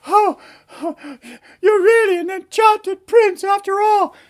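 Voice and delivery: high, gasping delighted falsetto; amazed princess. Acted. Princess sees frog become enchanted prince, amazed princess voice. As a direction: marvel breathlessly as a princess.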